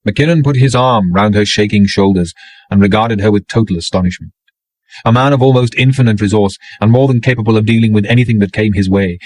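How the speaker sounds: ordinary delivery, nothing marked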